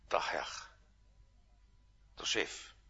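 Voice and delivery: whisper. Acted. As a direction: breathe the words out in awe. Awed naming of the Lord.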